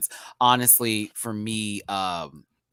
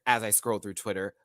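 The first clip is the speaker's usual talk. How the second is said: ordinary talk, nothing marked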